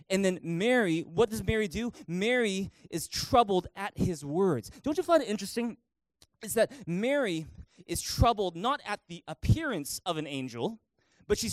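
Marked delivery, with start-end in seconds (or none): none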